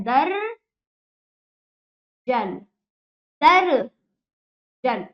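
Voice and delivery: दर्जन very slowly as "darr... jan", r held, then a gap; split syllables. Shown as a demonstration. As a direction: break दर्जन after the stretched र. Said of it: "द और जा के बीच में उच्चारण हो रहा है".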